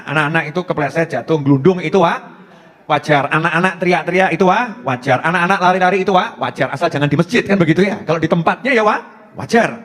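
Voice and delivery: ordinary delivery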